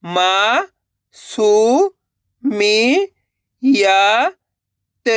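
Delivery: each syllable held long and separate, vowels swooping up, last one dropping. Shown as a demonstration. Teacher sounding out a word for learners, syllable by syllable. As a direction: spell it out sound by sound with pauses. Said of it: "एक अक्षर का उच्चारण करना है".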